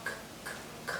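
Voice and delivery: unvoiced "k, k, k" in separate puffs; crisp isolated "k, k, k" for phonics; letter sound. Shown as a demonstration. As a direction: sound out "k, k, k" on its own. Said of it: "cow starts with".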